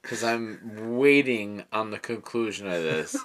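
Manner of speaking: muffled behind hands; face in hands. Real, not acted. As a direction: talk through your hands. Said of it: "I have my hands over my face".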